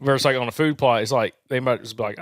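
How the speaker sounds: normal talking voice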